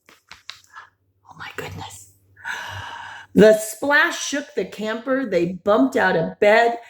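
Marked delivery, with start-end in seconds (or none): 0.1-2.1 hushed awed whisper; softly whispered amazement. Pretend. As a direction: breathe it out in wonder. Awed aside while reading.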